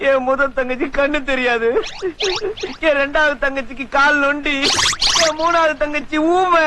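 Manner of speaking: high, slow whimpering lament over his sisters; milk the sympathy with a put-on sob; hammed-up tearful wail; fake crying. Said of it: "ஏன் மச்சான் அழுறீங்க?".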